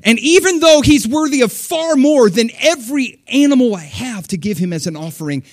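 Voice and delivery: rising, fervent pledge of devotion; passionate worshipper. Retold or imitated. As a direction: declare it like a devoted giver. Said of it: "He's like".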